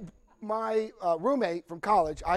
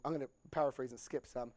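ordinary delivery

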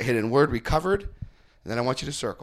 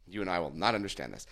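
ordinary talk, nothing marked

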